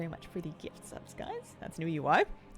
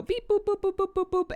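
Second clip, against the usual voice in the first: machine beeping; 13 semitones higher, 7 dB louder than usual. Retold or imitated. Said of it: "they just go".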